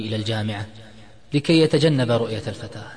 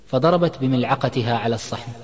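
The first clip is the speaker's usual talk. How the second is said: plain reading voice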